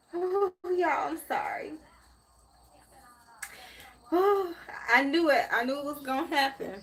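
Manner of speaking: breathy whew, fighting back real tears; tearing up. Real, not acted. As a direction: let the emotion break through, apologising. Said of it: "That's why I'm getting emotional".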